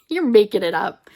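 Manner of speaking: loud retold protest with a laugh; laughing, teasing outburst; laughing mock accusation.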